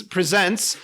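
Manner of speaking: ordinary talk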